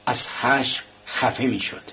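usual speaking voice

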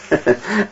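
soft little narrated laugh; a brief, quiet chuckle to oneself; short chuckle. A narrator's reading. Raskolnikov smiles after his confession, a soft chuckle. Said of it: "سپس لبخندی زد".

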